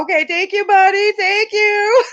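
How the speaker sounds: high voice climbing into a squeal; re-enacted cooing to a child; gushing thanks. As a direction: draw out the last thanks, high and doting. Retold thanks to son for gift.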